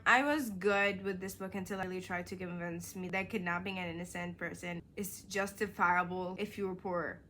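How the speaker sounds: ordinary delivery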